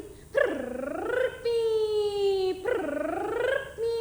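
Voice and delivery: drawn-out whistling snore sound; snoring through a whistle. A narrator's reading. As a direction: stretch the snore into a shrill whistle. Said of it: "सांस लेती तो आवाज निकलती".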